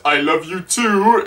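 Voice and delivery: ordinary talk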